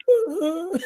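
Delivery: high, put-on wail; fake crying. Pretending to cry on the phone, fake wail. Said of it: "I was pretending".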